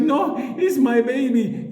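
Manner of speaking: put-on high, slow pleading old lady voice. Old lady to Cameron over the bird: high, slow pleading. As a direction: plead for the pet.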